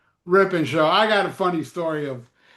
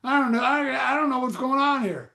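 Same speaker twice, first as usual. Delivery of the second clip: confused reader; 6 semitones higher than usual. Retold or imitated. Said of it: "I'm like".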